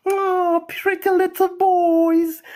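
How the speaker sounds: put-on high, slow cooing over little boys; mock cooing. Mocking weak men as babied boys, high slow coo. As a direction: coo at them like babies, sarcastically.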